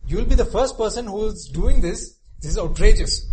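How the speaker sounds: loud, high pleading reasoning; urgent pleading. Retold or imitated. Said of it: "It's saying".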